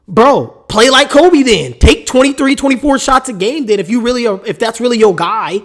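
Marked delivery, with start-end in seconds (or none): 0.1-2.6 annoyed. Real, not acted. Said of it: "it just makes me mad".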